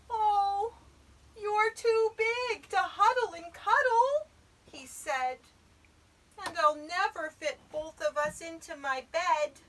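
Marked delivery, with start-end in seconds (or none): 0.1-4.3 high, loud, dismayed little voice; little Eddie's dismay. A narrator's reading. Eddie laments the bear's size. 6.4-9.6 higher, loud complaining little voice; little Eddie's dismay. A narrator's reading.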